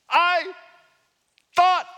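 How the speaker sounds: loud, high, offended "I thought"; mock-outraged "I thought"; indignant I thought. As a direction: spit it out like a man insulted. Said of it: "And he says".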